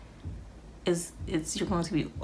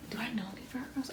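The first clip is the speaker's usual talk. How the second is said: quick whisper to herself; quiet whispered aside while reading the cards; 5 semitones higher, 11 dB quieter, much faster than usual. Real, not acted. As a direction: whisper it fast, half to yourself.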